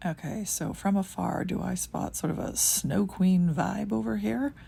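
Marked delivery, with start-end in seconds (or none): none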